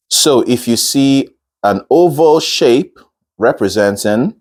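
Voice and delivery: ordinary delivery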